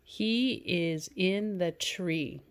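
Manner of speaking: slow, even reading of the sentence; slow read-aloud. Shown as a demonstration. Teacher reads the sample sentence to students slowly. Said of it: "How about this one?".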